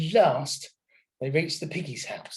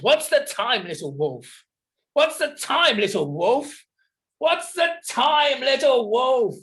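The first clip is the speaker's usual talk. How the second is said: building from raised to high, loud wailing; tantrum; 9 semitones higher, 5 dB louder than usual. Acted. Smells throws a tantrum at the brick house, escalating wailing yell. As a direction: yell it louder each time like a child in a meltdown. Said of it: "Snells had a tantrum".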